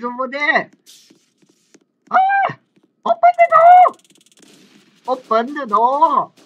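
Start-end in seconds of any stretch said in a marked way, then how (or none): 2.1-4.0 very high, loud squeal; panicked shriek. Real, not acted. Locked in, yelling for door. 5.1-6.3 loud, slow, drawn-out demand; insistent shout. Real, not acted. Locked in, yelling for door.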